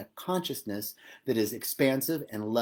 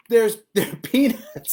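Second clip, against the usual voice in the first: louder, higher, laughing through the repeat; laughing disbelief; 7 semitones higher, 7 dB louder, slower than usual. Real, not acted. Speaker telling viewers about peanuts in her plants, laughing. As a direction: laugh at the absurdity.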